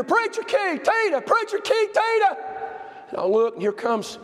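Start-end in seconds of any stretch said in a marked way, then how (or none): 0.0-2.4 high call across a rainy lot. Retold or imitated. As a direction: holler to catch them leaving. Woman calling across parking lot.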